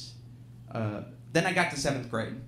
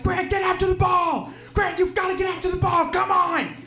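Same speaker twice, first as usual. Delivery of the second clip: much higher, loud frantic sideline yell; dad yelling from stands; 6 dB louder than usual. Retold or imitated. Dad in the stands yelling at his five-year-old son, frantic shout. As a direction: scream it like a dad losing his mind at pee wee soccer. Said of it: "he is losing his mind".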